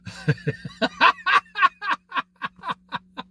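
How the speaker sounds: high, long run of laughter. Real, not acted. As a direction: crack up at your own joke. Laughing at his own joke.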